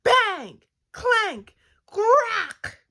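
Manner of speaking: slow, loud, high clanging words; booming storybook sound effects; climactic crashing. A narrator's reading. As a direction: hit each noise like a hammer blow. Climactic bed noises.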